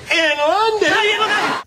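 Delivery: very high, slow, laughing squeal on London. Real, not acted. Cohost squealing the words back through laughter. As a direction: stretch it out, cracking up. Playful London call and response.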